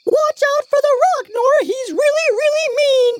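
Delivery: a tiny squeaky piece of furniture; talking dresser. Acted. Dresser warns Nora.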